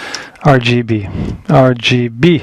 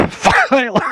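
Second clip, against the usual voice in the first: high laugh breaking through the words; 11 semitones higher, 4 dB quieter than usual. Real, not acted. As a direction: laugh it off mid-sentence. Laughs after fumbling a word.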